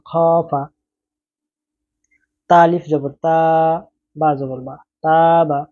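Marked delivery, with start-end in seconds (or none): none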